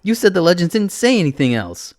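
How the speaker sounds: high, loud accusation; upset. A narrator's reading. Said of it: "I get upset hearing her say that".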